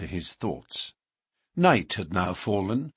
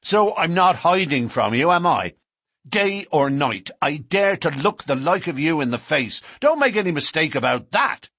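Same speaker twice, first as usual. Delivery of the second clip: defiant challenge; 6 semitones higher, 4 dB louder than usual. A narrator's reading. McMurdo challenges the police before the whole carriage, loud and defiant. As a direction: dare them to come for him.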